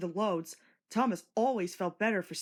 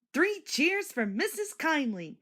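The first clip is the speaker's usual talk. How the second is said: higher, slow loud cheer; joyful cry; 9 semitones higher, 4 dB louder than usual. A narrator's reading. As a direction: bubble over with joy. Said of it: "he cried".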